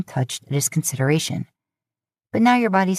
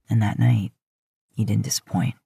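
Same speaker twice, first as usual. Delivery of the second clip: low flirtatious Dean; 6 semitones lower than usual. Acted. Dean teasing, low, soft.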